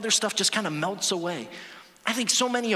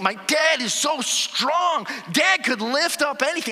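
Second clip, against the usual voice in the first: put-on kid's admiration; 5 semitones higher, 3 dB louder, slower than usual. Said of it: "I used to be Superman".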